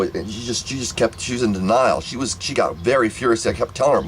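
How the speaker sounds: ordinary delivery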